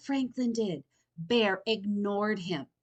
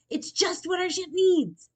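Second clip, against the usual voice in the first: higher, louder and eager; excited; 10 semitones higher, 7 dB louder than usual. A narrator's reading. Franklin to Bear, excited about the flag. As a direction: keep the delight going. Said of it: "exclaimed Franklin".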